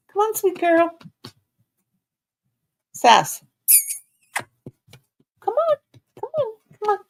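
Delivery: high loud coaxing pet talk; high cooing call to the puppy; coaxing the dog. Real, not acted. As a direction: coax her up in a high sweet voice. Coaxing dogs up.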